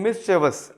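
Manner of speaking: mischievous as three syllables, MIS-chuh-vus; short "chuh-vus" ending on mischievous; correct pronunciation. Shown as a demonstration. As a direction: stress the first syllable of mischievous. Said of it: "अब आपको ये pronunciation".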